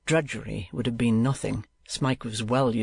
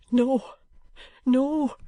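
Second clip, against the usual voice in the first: put-on eager pleading boy; 12 semitones higher than usual. A narrator's reading. Smike clasping his hand.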